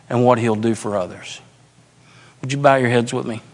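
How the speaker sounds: low, soft, spaced-out preaching; solemn altar appeal. Real, not acted. Pastor promises congregation their story changes lives, hushed earnest appeal. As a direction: bring it down gently, pausing between phrases. Quiet appeal closing the sermon.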